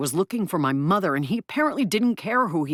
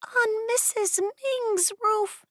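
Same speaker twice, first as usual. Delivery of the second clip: high, slow, hushed voice; whispering goblin; much slower than usual. Acted. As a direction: whisper it even softer. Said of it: "Tinja whispered even more softly".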